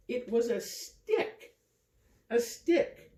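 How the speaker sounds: high, wondering voice; lifted, marvelling tone; delighted reveal. A narrator's reading. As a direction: say it like showing a surprise. Narrator shows the stick.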